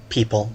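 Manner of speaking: "people" set apart after a pause, dropped low and soft; "people" spoken low and meaningful; odd emphasis on people. Acted. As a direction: let "people" fall quiet and loaded after the beat. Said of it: "You put a strange emphasis on the word people".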